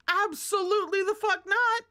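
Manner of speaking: loud high outraged yell; indignant shout. Real, not acted. Reader refuses villain's order.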